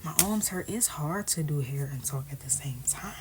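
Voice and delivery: normal talking voice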